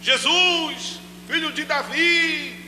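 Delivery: high, drawn-out call; Bartimeu crying out. Acted. Blind man calling to passing Jesus, high long cry. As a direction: call out slowly to someone passing by. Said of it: "imagine Bartimeu aí gritando".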